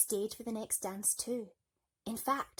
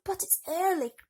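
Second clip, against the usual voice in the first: calling after her; 10 semitones higher, 7 dB louder than usual. Acted. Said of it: "called the prince".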